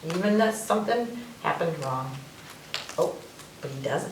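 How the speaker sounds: ordinary delivery, nothing marked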